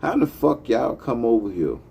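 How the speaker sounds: ordinary talk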